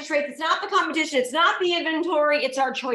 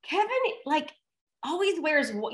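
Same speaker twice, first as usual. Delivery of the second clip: little daughter; 3 semitones higher, 4 dB quieter than usual. Retold or imitated. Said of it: "she's like".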